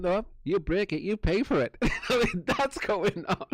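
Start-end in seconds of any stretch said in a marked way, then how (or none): 0.0-1.8 higher, cheeky comeback; answering the cop about the door, higher cheeky; cheeky retort. Retold or imitated. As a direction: throw it back at them smugly. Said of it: "I'm like".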